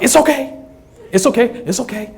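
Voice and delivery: softly coaxing, falsely reassuring; hushed mother calming her kids; mock soothing mama. Mama calls her frightened boys over, softly reassuring.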